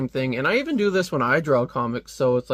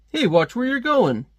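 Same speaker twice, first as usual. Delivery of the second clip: higher, louder hey watch it; annoyed passerby; 10 semitones higher, 4 dB louder than usual. Retold or imitated. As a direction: snap at someone who bumped you. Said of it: "he's like".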